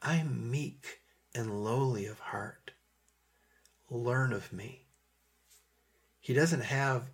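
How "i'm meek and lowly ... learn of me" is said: slow, meek voice with long pauses; Jesus, kind and gentle. Acted. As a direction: speak humbly and tenderly, unhurried. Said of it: "is kind and gentle".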